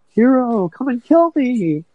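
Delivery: high, drawn-out mock cry; mock yell. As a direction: call out in a high voice, imitating her. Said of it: "she yells".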